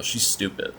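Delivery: a breathy confiding whisper; whispered aside. Real, not acted. Commentator whispering a blunt verdict to viewers, hushed.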